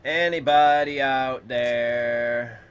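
loud, slow, drawn-out call; long singsong call to anyone listening. Pretend. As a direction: holler into the void, stretching each word. Calling out to stream viewers.

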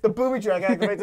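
ordinary talk, nothing marked

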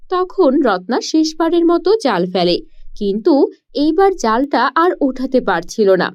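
usual speaking voice

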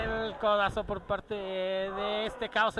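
ordinary talk

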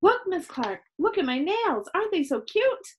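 high, eager, sing-out voice; excited little show-off. A narrator's reading. Chloe showing Miss Clark her nails, excited.

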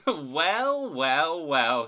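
loud, falling "well, well, well"; exclaimed. A narrator's reading. Percy sees Thomas run off, exclaiming. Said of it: "he exclaimed".

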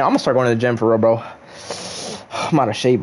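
normal talking voice

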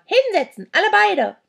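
loud, high, cross order; shrill barked order; bossy command. A narrator's reading. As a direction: order them down like a cranky crone. Old creature orders both to sit.

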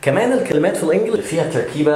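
ordinary talk, nothing marked

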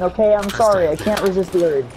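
ordinary talk

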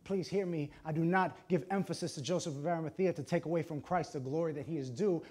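normal talking voice

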